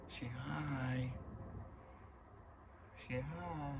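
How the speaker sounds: soft, high pet-talk voice; high, quiet sing-song coo; coaxing a pet. Real, not acted. Coaxing the cat to greet viewers.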